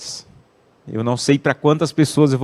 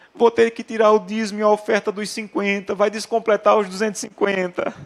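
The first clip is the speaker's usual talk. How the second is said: high, whiny child's voice, mock tearful; imitated crying child; 8 semitones higher than usual. Preacher imitating his tearful son explaining the tithe, high and whiny. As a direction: whine it like a boy losing his savings. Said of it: "começou a chorar".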